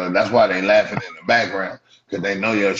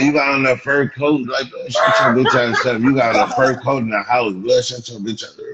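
loud, raised, mocking yell; loud roasting; 5 semitones higher, 6 dB louder than usual. Real, not acted. Streamer yelling at a viewer wearing a fur coat, loud roasting. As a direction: shout him down over the fur coat.

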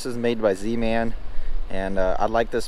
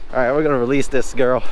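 normal talking voice

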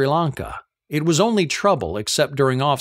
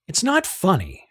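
higher, clipped angry retort on not; snarled; 6 semitones higher than usual. Acted. Lizzie after being called a marital abuser, snarling it high. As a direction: snap back that it's not funny. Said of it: "she snarled".

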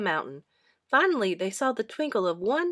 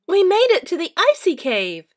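much higher, loud triumphant cheer; 10 semitones higher, 10 dB louder than usual. A narrator's reading. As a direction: celebrate arriving at last. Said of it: "cheered Dora".

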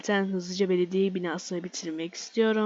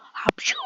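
sneeze. Real, not acted. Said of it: "Hapşırık mı?".

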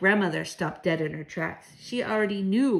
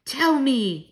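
higher, louder order; snapped demand; 7 semitones higher, 6 dB louder than usual. A narrator's reading. Grandmother demands the child's answer, snapping. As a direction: demand it in a raised voice. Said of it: "my grandmother snapped".

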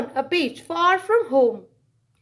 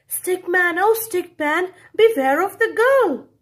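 warning refrain; 5 semitones higher, 5 dB louder than usual. A narrator's reading. Narrator's sung-out refrain warning.

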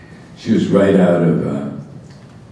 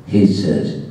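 ordinary talk, nothing marked

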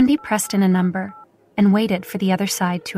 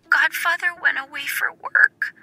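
put-on high little girl voice, a touch of sadness; mild tearful child; 7 semitones higher than usual. Wendy sadly tells Uncle Aiden her godfather is away. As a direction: sadly explain like a small child. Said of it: "Wendy cried very sadly".

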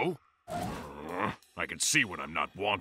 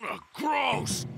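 disgusted shout. Acted. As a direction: yell it in disgust. Disgusted by something on delivery.

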